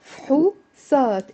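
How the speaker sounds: فحوصات broken into fhu, saat; two syllables. Shown as a demonstration. Teacher showing the Lebanese dialect, in two separated syllables. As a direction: say فحوصات with f and h run together, then a long saat. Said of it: "It's two syllables in the Lebanese dialect".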